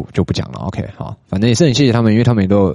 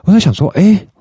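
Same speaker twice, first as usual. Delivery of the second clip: much higher, louder burst of insight; light up with an aha moment; sudden realization; 12 semitones higher, 5 dB louder than usual. Real, not acted. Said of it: "我豁然开朗欸". (恍然大悟地惊呼，声音又高又响)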